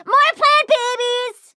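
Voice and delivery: high, slow cooing; slow, high baby talk for plants; gushing. Acted. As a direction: gush over the little plants. Delight over plants.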